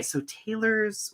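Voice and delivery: normal talking voice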